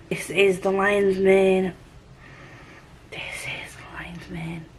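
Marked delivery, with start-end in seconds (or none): 3.1-4.7 slow, hushed whisper. Pretend. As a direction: whisper it slowly, drawing it out. Repeats the line in a whisper.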